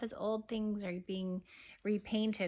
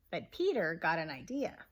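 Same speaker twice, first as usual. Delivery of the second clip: ordinary delivery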